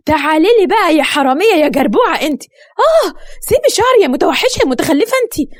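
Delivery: high, heated attack with pained yelps; acted catfight outburst; spiteful fury. A narrator's reading. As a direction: spit out insults in a high voice. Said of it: "وهي بتتكلم بغل".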